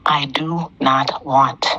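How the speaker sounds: "I do not want" slowed right down, each word separate; emphatic repeat. Real, not acted. Caller making sure Damien heard, "I do not want" slow and emphatic.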